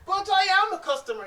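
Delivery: high, loud protest; indignant. Acted. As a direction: insist you belong here. Lutek protests to the shopkeeper.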